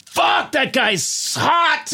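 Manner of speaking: high, drawn-out mock shout; slow, hammed-up cry of admiration; passers-by exclaiming. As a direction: exclaim like a stunned admirer on the street. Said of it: "people are like".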